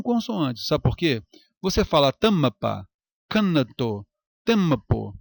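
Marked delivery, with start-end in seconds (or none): 2.1-2.9 "tampa" com M de consoante, ta-ma-pa; pronúncia errada. Shown as a demonstration. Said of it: "Não fala isso". 3.3-5.2 M e N como sílabas em "canato, tempo"; pronúncia errada. Shown as a demonstration. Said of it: "Não fala isso".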